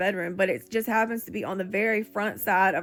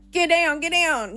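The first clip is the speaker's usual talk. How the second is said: loud, high re-enacted shout; acted-out urgent yell to a partner; retold holler; 8 semitones higher, 7 dB louder than usual. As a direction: holler it as a warning, re-enacting the panic. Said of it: "I'm, like, hollering at my boyfriend".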